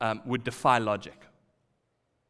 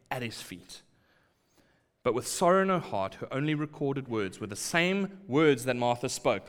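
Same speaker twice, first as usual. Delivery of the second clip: ordinary talk, nothing marked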